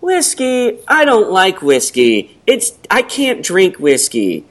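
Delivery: high, slow, put-on reluctance; radio ad woman. Ad woman refusing the man's offer, exaggerated reluctance. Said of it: "She goes".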